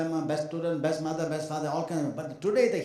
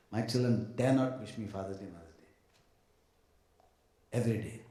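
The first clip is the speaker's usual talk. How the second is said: ordinary talk, nothing marked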